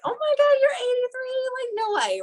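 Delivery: very high squealing amazed voice; high-pitched gushing disbelief at his age; gushing fan. Retold or imitated.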